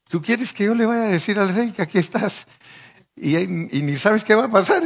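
high, agitated objection; acted alarm, pitched well up; incredulous protest. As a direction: protest as if handed a deadly errand. Messenger protests to Elijah in story.

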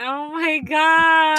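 loud, drawn-out astonishment; real shock. Real, not acted. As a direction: stretch it out, appalled and amazed. Shocked at the niece's age.

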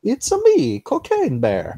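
high, loud Italian plumber voice; hammed-up Mario impression. Mario voice joke on Cocaine Bear.

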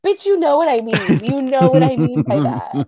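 loud acted snap-back; exasperated comeback. Acted angry comeback to slow talker.

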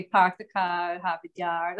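Boston accent with dropped r's, "car" as "cah", "Harvard Yard" as "Hahvahd Yahd"; light Massachusetts accent demo. Shown as a demonstration. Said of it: "they can catch the Massachusetts accent".

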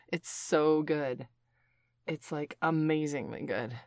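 slow savoring delight. Acted. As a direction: draw it out, savoring. Tasting the sauce.

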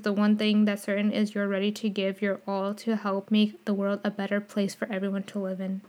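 usual speaking voice

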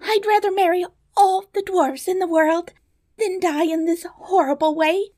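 high, panicked princess plea; desperate cry. Acted. Princess chooses dwarfs over death by lions, high desperate cry. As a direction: bargain for life, pitched far up. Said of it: "cried the princess".

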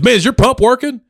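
high, loud mock demand; annoyed guy. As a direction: imitate the irritated stranger. Angry guy barking at narrator.

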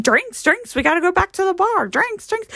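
high, excited call for drinks; rallying dad. Retold or imitated. Daughter mimics dad after the haunted house, excitedly rallying. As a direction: imitate Pop eagerly herding everyone to the bar.

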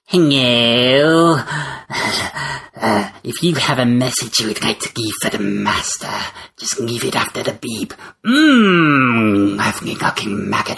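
creepy servant answering phone; unhinged servant of the master; Renfield voice. Acted. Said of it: "his manservant Renfield".

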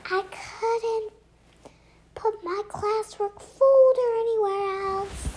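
high, distant voice through fabric; muffled from outside. Acted. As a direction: speak from outside the bag. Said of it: "Lisa's voice echoed from outside".

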